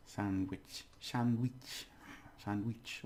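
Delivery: "Sandwich" with an "ee" in "wich"; Spanish pronunciation. Shown as a demonstration. Teacher drilling the E sound, Spanish pronunciation. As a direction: repeat "Sandwich" softly as "sand-weech". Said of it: "it's the E sound in there".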